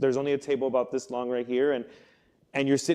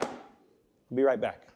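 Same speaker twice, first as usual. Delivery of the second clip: detective leaving the suspect alone, slow and ominous; menacing detective; 2 semitones lower, 3 dB quieter, much slower than usual. Retold or imitated. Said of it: "He's like".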